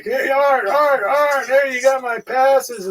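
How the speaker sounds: re-enacted calling out. Fighter hailing the promoter about his passes, high insistent call. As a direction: call over and over for attention.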